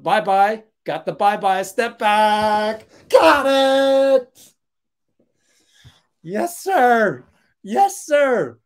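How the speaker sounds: loud, high excited play call; real hype as the step-back lands; hype shout. Real, not acted. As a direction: yell it like the shot just dropped. Hyped live call of a shot.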